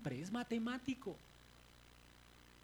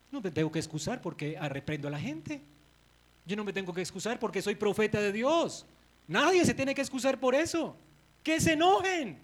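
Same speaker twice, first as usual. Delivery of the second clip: building louder and higher; voicing Moses' defiance; 3 semitones higher, 6 dB louder than usual. Moses refusing to excuse his anger, building to a loud peak. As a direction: refuse to apologise, rising to a shout.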